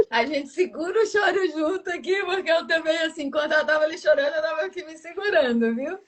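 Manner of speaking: high, strained voice fighting back a cry; choking up while trying to comfort; holding back tears. Real, not acted. Host to crying guest, holding back her own tears. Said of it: "eu tô chorando aqui".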